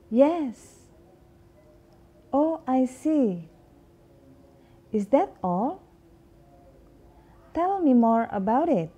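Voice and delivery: teacher modeling listener responses; model dialogue reading. Shown as a demonstration. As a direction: act out the replies for students to copy. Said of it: "repeat after me".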